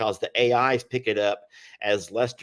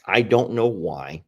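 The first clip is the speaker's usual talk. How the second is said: normal talking voice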